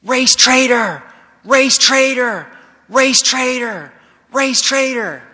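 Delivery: high, loud, slow mob chant; yelling, imitated. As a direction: hurl it out as a repeated crowd taunt. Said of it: "They start yelling".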